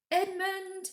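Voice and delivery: high calls into the snow; calling out. A narrator's reading. Children calling for their lost brother, high. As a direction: call his name across the snow. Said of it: "they called till they were hoarse".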